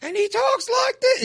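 squeaky-high mock voice, far above normal; high-pitched impression. Host mocking an annoying YouTuber's voice, high-pitched impression. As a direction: imitate someone's very high-pitched voice. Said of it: "Isn't it real high-pitched".

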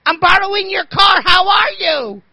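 high, loud sing-out holler; hammed-up breezy mom calling out; unrattled mother. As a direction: imitate her casual shout, way up high. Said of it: "She's not the least bit rattled".

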